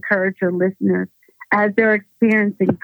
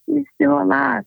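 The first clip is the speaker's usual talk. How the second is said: high, pleading denial; pitched-up cry of denial; desperate disbelief; 7 semitones higher than usual. Retold or imitated. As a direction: refuse to accept it, voice jumping up. Disbelieving her son is dead.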